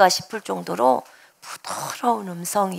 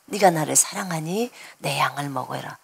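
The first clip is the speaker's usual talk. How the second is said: soft low voice; 8 semitones lower, 6 dB quieter than usual. Retold or imitated. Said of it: "이렇게 말씀하셔요".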